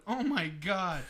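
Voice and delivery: high, loud gasp of dread; retold shock. Learning he ran by the roundhouse, retold shock. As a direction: act out the dawning horror, loudly. Retelling shock at haunted house.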